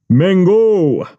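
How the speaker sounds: high Jamaican voice; savoring Jamaican accent. Retold or imitated. Boss voiced in story.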